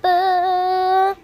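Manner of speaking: a real cough. Real, not acted. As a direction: cough once. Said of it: "Batuk, guys, lagi batuk".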